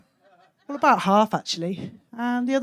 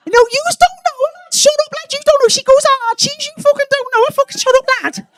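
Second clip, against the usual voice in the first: very high, loud squawking lad; cocky year-nine boy; 13 semitones higher, 7 dB louder than usual. Acted. Boy insisting to classmates they don't know her, high shouting. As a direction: squabble back at doubting mates. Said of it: "the cock of year nine".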